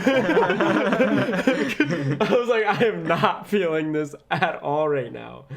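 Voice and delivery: laughing while telling. Real, not acted. Laughing retelling awkward moment.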